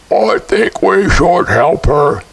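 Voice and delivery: slow, otherworldly suggestion; a third spirit proposing help, unhurried; strange spirit voice. A narrator's reading. Spirit urging the others to help, slow and eerie. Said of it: "none of them sounded quite like people".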